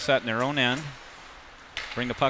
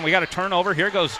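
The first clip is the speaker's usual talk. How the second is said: excited; 6 semitones higher, 5 dB louder than usual. Real, not acted. Goal chance in play-by-play.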